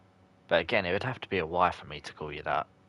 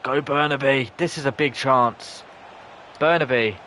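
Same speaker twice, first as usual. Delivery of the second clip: higher, louder urging as the attack builds; excited cheering; 7 semitones higher, 6 dB louder than usual. Real, not acted. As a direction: push the striker on. Big chance in live match.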